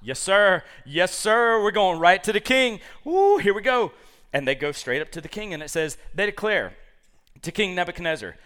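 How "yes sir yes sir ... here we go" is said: loud, eager yes sir, higher; hammed-up guards rushing to tell on them. Guards rushing to the king, loud and eager. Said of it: "they're like".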